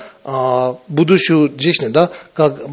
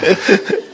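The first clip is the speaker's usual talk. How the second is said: laughing; 3 semitones higher, 5 dB quieter than usual. Real, not acted. Laughs after Russian joke.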